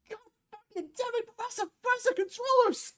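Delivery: mock his cursing in a tiny voice; squeaky Bruce parody. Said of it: "I imagine it sounds like".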